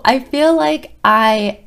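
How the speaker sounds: a laugh breaks into the words, slow; half laughing, overwhelmed with gratitude. Real, not acted. Counselor telling her coworker she doesn't deserve her, laughing.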